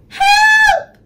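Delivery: very high, loud cry for help; frightened cry. A narrator's reading. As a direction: squeal for help in panic. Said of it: "the animals cried".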